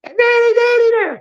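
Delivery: put-on high shrieking child's cry of daddy; child screaming for daddy. Daughter in the shower calls her father, panicked high scream. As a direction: scream for daddy like a frightened little girl. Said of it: "she starts screaming and hollering".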